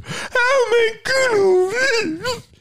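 whiny mock complainer. Mocking people who say they must work, high whiny voice. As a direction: whine like a lazy kid. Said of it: "I hate that answer".

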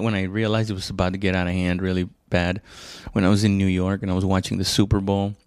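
usual speaking voice

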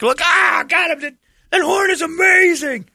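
put-on very high, loud kid shouting with glee; thrilled little kid at the window; excited kid voice. Kid excited by truck horn.

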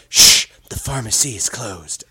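shush, then deliver a hushing announcement low; mock song interjection. Host mimics odd lyric in sponsored song, mock hushing interjection. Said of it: "every now and then you get".

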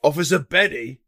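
normal talking voice

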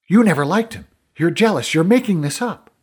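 loud, high, heated accusations; angry accusation. Acted. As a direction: hurl each accusation, high and forceful. Essa turns accusingly on the narrator.

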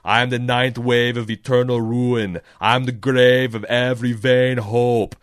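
loud, grandly declaimed action-hero chant; Arnold Schwarzenegger. Acted. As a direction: commit fully to the booming incantation. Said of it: "sounds like I'm doing an Arnold Schwarzenegger".

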